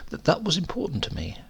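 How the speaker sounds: plain reading voice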